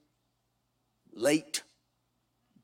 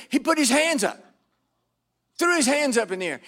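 normal talking voice

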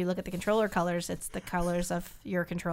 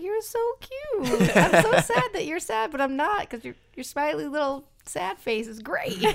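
put-on cooing baby talk; 7 semitones higher, 4 dB louder than usual. Gushing over the sad little Sobble, cooing high. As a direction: coo at it like a sad puppy.

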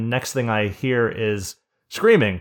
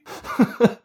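breathy laugh; 14 semitones higher, 4 dB louder than usual. Real, not acted. Speaker laughs at story.

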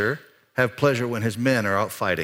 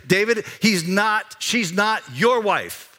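servant's pointed warning; 9 semitones higher, slower than usual. Retold or imitated. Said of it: "what the servant says".

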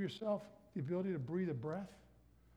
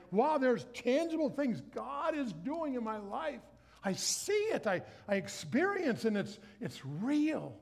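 higher, slow wonder; amazed; 6 semitones higher than usual. Retold or imitated. A new giver telling the congregation, higher and amazed. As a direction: marvel like seeing it at last. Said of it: "You're like".

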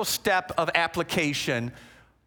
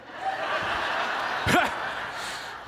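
laughing; 11 semitones higher, 4 dB quieter than usual. Real, not acted. Laughing at the hug story.

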